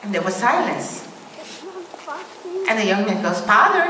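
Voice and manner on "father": calls out high and loud into the empty church; calling out. Retold or imitated. Young man calling out for the vanished priest, loud and high. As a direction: raise the voice to find someone gone missing.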